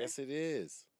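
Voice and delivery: high, loud contradiction; loud insistence. Real, not acted. Host insisting to guest in a squabble, high and loud. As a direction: argue back at high pitch and volume.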